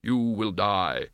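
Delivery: slow, solemn Death voice; measured, unhurried, otherworldly Death; grim reaper voice. A narrator's reading. Death states flatly.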